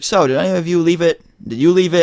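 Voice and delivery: high-pitched mock interrogation; imitated cop questioning. Cop singles out a student on the bus, imitated questioning.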